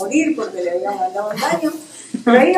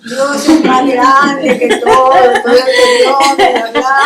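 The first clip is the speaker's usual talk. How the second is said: hammed-up pulpit tone, laughing at herself; mock preacher; 8 dB louder than usual. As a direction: preach it with a grin. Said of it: "me sentí predicador".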